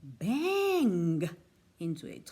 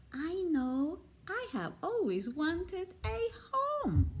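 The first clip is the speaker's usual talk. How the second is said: higher, wistful teddy bear voice; dreamy little bear; 6 semitones higher than usual. A narrator's reading. Toy bear voiced in story.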